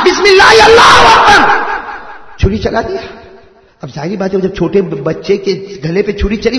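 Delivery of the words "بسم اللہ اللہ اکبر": loud, high mock takbir; child shouting. Older brother playing at slaughter, shouting the takbir.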